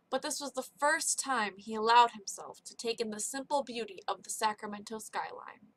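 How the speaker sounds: usual speaking voice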